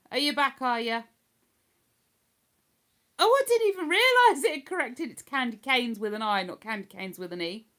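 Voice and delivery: ordinary talk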